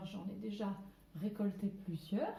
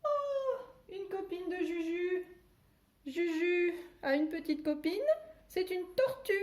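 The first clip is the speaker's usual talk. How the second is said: very high "Oh!" then high, loud glee; delighted surprise; 9 semitones higher, 3 dB louder than usual. Pretend. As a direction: gasp with delight at the turtle. Discovers toy turtle. (surprise ravie, très aiguë)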